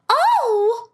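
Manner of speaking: sharp gasp, then a high Oh; real startled gasp and high cry. Real, not acted. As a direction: caught off guard, gasp and cry out. Sudden emotional moment in the show.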